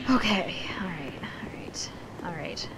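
whispered all right; whispering pep talk. Real, not acted. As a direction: whisper to psych yourself up. Psyching up before fight.

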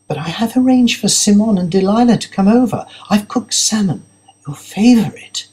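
disappointed partner holding her plans together; lightly voiced fiancée. Narrator voices fiancée Zara.

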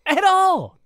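high, loud outburst of agreement; exasperated shout. Real, not acted. Podcaster agreeing they don't look like superstars, exasperated shout. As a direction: yell it out in disbelief, each burst on its own.

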